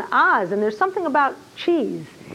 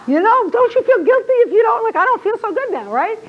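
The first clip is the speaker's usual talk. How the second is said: high, louder whiny mock guilt; 9 semitones higher, 4 dB louder than usual. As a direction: play the guilt-ridden customer. Teacher mocking guilt from ad.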